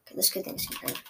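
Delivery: let's get things in right whispered under the breath. Real, not acted. Muttering to himself while setting up the game, whispered. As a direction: murmur it to yourself, barely voiced. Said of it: "said twice, whispered then aloud".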